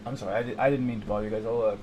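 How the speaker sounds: usual speaking voice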